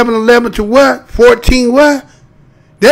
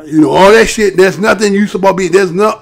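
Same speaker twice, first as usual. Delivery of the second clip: normal talking voice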